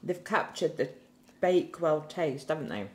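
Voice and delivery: chewing between words; talking around pastry; mouth partly full. Real, not acted. Seen 0: Woman holds a bitten pastry, mouth working between words, two eaters.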